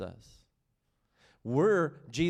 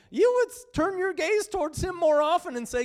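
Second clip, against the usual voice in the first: normal talking voice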